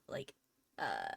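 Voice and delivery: usual speaking voice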